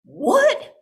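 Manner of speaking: high, loud startled "What?"; sudden high yelp of shock. Acted. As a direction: jolt up in disbelief. Shocked outburst at the tale.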